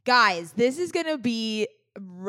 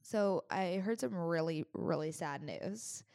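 mock sad; 3 semitones lower, 8 dB quieter, slower than usual. Mock tragic news delivery.

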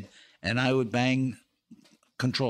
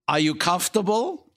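lightly voiced wife, pitched up and louder; higher, rising mock inquiry; imitating a wife's loaded question; wife's pointed question; 7 semitones higher, 5 dB louder than usual. Wife's pointed question in retold scene.

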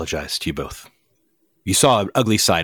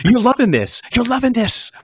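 "loving" pitched way up; high accusation. Acted. To Gray, accusing him of enjoying it, a high squeal.

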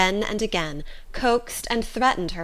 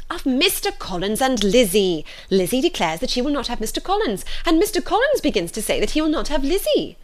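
raised, emphatic matron voice; agitated mother, climbing higher towards the end; exasperated wife; 6 semitones higher, 4 dB louder than usual. A narrator's reading. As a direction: spell out the crisis to an obtuse husband. Voices Mrs Bennet, exasperated with husband.

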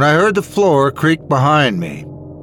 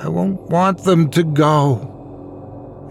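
put-on slow, higher-pitched sad little girl; sniffling child; 5 semitones higher, much slower than usual. A narrator's reading. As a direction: plead sadly, drawing the words out. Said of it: "sniffled softly".

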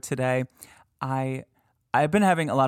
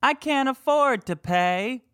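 much higher and louder ghost mimicry; mock EVP voice; 11 semitones higher, 4 dB louder than usual. Host offering a guess to listeners, high mock ghost voice. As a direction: sing out the option like the recording.

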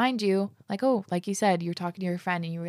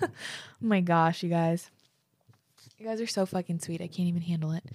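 teary. Real, not acted. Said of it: "because I am crying at the moment".

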